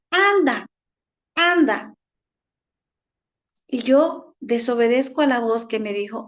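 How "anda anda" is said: higher, falling coaxing call; tempter's voice. Acted. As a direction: urge it on, lightly acted as the tempter. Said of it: "la otra voz me dice".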